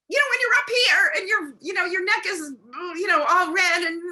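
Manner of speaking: high, loud, strained pitch; up here shrill pitch. Shown as a demonstration. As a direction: show the upset high voice. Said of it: "super sensitive to tone of voice, to a pitch".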